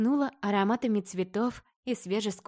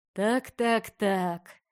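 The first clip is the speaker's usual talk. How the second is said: slow, sneering "так, так, так"; mocking; much slower than usual. A narrator's reading. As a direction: draw it out with scorn. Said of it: "издевательски хмыкнул он".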